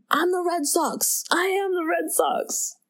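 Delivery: high-pitched yell without the volume; high, insistent retold shouting; mock yell. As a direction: imitate a man yelling, held back. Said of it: "Jimmy Fallon is just yelling".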